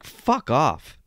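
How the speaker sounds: usual speaking voice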